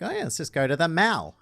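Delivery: quick rural Victorian voice, "mall" as mal; rural Victorian accent. Retold or imitated. Uni flatmate mimicked, rural Victorian accent, mall as mal. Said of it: "who was like".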